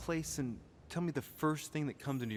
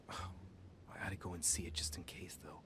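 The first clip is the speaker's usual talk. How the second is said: whispered aside; 5 semitones lower, 8 dB quieter than usual. Acted. Player whispers the character's line.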